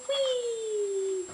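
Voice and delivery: put-on a delighted high "whee" for the baby; high playful whee. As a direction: squeal it way up high.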